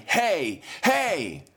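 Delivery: hey shouted high, climbing sharply; retold yelling. Preacher re-enacting the train yell, rising high shouted hey. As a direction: call out like a stranger across the carriage. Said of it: "this young man yelling".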